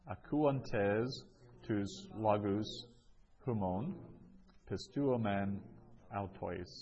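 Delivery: measured, with clear pauses between phrases; word-by-word reading. Shown as a demonstration. Teacher repeats a Greek sentence for students, word by word. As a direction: break the Greek into separate chunks for learners.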